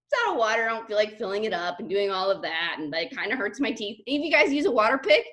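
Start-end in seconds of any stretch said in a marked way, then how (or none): 0.1-4.0 mock-tired complaint; whiny. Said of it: "I'm like".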